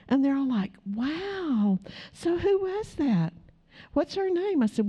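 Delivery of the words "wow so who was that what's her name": curious friends. Retold or imitated. Friends at the tent quizzing her.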